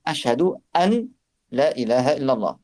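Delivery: "ashhadu an la ilaha illallah" with the n of "an" sounded clearly; written form. Shown as a demonstration. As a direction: say "an" then "la" as two separate syllables. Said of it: "à l'origine, c'est marqué". ("ashhadu an la" en prononçant bien le noun)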